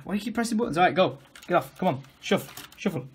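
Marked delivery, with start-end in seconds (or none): none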